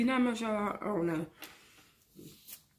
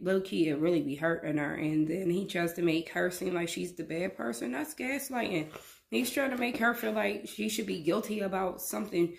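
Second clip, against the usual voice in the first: ordinary delivery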